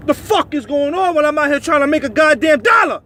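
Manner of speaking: blowing up over lost money; angry shout. Real, not acted. Trucker venting about being stalled, yelling in real anger.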